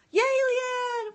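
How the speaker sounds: loud, high-pitched real whoop; excited cheer. Real, not acted. Streamer cheering for Leanne, excited whooping.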